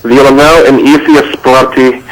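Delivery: put-on hammy Russian accent with a laugh. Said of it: "try and do a Russian accent".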